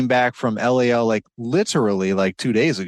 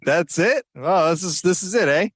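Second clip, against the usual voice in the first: mock Canadian guest; 6 semitones higher than usual. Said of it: "he's probably like".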